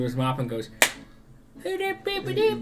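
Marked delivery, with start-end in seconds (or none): 1.6-2.6 very high squeaky gibberish; scared goblin. Acted. Frightened goblin clutching his mop, high scared babble. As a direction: panicky little goblin babble, pitched way up. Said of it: "gets really scared as he clutches".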